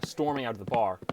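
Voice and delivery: ordinary delivery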